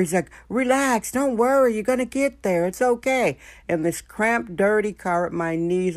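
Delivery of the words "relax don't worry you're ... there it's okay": imitating the driver's soothing. Voicing driver telling her relax.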